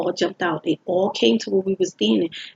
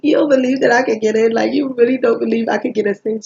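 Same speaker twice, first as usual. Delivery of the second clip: indignant, louder, pressing the point; mad; 3 semitones higher, 4 dB louder than usual. Retold or imitated. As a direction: push back, hurt she doubts you. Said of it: "I was so mad".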